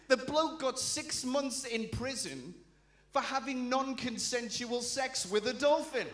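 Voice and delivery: ordinary talk, nothing marked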